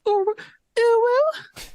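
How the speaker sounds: tiny, very high squeaked uwu; cutesy uwu. Pretend. Co-host practising a requested uwu, cutesy high voice. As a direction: push the voice way up high. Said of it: "see if I can do a".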